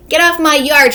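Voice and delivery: angry shout. Acted. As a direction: shout at the pig through the glass. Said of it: "she shouted at Mercy".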